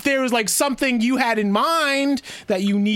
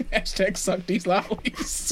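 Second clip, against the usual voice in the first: laughing; 5 dB quieter, slower than usual. Real, not acted. Laughing while joking.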